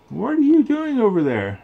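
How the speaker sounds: Bo Peep. Acted. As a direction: ask like a surprised shepherdess. Bo Peep asks Woody.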